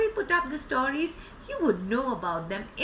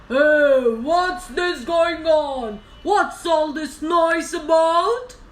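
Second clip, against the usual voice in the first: loud, slow, raised lion roar; 4 semitones higher, 9 dB louder, much slower than usual. Acted. Lion roars at the noisy elephant, roaring. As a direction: roar the words out, drawn out. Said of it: "the lion roared and said".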